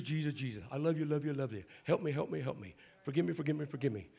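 rote frantic prayer. Acted. Preacher mimicking a shallow prayer to God, rote frantic pleading. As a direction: rush the same pleas out in loud bursts.

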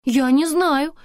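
high, loud, alarmed denial; frightened. A narrator's reading. Antonina asked about hair dye, alarmed. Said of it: "испугалась Антонина".

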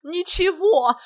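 high, loud mocking whoop; gleeful clownish yell. A narrator's reading. Silly guest echoes the hero, high loud gleeful yell. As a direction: crow it loudly, on the edge of laughter. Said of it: "Иван хохотал так громко".

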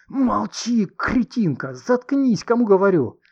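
high, tense hissed order; angry hiss. A narrator's reading. Man silencing his attacker in public, furious hiss. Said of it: "опомнился и зашипел".

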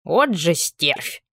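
put-on slow, creaking mutter of a tiny old man; vexed creaky grumble. Cranky little old man, vexed, creaking his curse slowly. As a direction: drag out the curse like a cranky house spirit. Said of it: "С досадой проскрипел".

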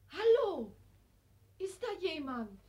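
put-on girl calling into darkness. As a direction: call out, unsure who's there.

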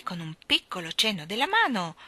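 ordinary delivery, nothing marked

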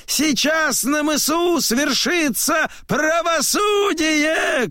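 very high, long-held proclamation; shouting. A narrator's reading. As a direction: cry it out to the whole crowd, high and sustained. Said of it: "кричит он".

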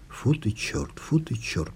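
hushed, near-whispered exasperation; repeated muttered curse. A narrator's reading. Woman cursing to herself at a dead end, muttering. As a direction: curse softly under your breath, over and over. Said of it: "повторяла она многократно".

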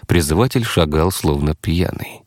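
plain reading voice

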